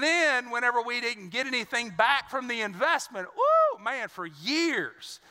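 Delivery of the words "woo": a loud, sudden high woo. Real, not acted. Pastor recalling years of fights over money, loud high woo. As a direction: yelp it out, way up high.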